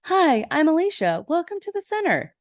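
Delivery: put-on high-pitched, sweet girlish greeting; put-on high voice of a welcoming girl. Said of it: "this really pretty, sweet girl".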